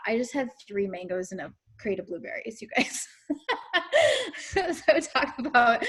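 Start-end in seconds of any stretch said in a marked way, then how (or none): 3.3-5.8 high laugh into the words; giggling while talking. Real, not acted. Laughing about three mangoes.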